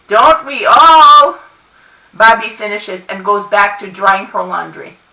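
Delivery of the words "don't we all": loud, drawn-out neighborly call. A narrator's reading. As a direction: call it down, stretching the words. Neighbor calling from the roof.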